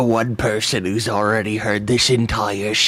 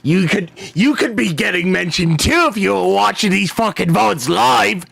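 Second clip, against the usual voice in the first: high-pitched, worked-up rant at viewers; mock scolding; 9 semitones higher, 3 dB louder than usual. Game master to viewers not watching live, high mock rant.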